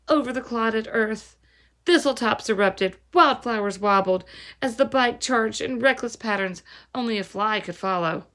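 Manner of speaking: ordinary delivery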